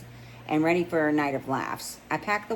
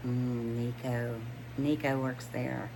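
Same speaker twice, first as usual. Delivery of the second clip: hushed inner thought; 4 semitones lower, 6 dB quieter, slower than usual. Acted. Private thought about Nico.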